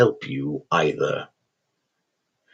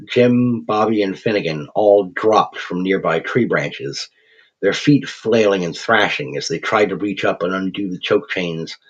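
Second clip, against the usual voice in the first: ordinary delivery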